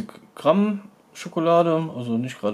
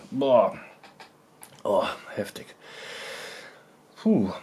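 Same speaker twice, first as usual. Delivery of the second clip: softer, pained from the heat; mouth burning from spicy food; 3 semitones lower, 4 dB quieter, slower than usual. Real, not acted. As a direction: breathe out the burn. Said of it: "nur ein kleines Stückchen abgebissen".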